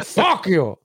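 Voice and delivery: high, laughing Pacino bark; Al Pacino impression. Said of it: "Let Al Pacino do it".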